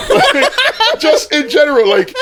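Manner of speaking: high squealing laughter through the words; can barely get words out for laughing; laughing hard. Real, not acted. Friend cracking up while arguing about sex.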